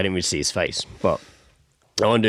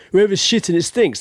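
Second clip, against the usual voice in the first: loud, higher call at the door; imitated heckler; 9 semitones higher, 4 dB louder than usual. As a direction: act out a lad calling out to whoever's inside. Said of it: "was kinda like".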